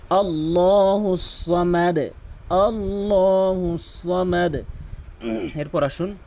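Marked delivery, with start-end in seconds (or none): 0.1-4.6 very slow melodic chant; slow Quran recitation. Shown as a demonstration. Teacher modelling the correct recitation slowly. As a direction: draw out আল্লাহু সমাদ. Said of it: "এরপরে".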